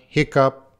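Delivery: usual speaking voice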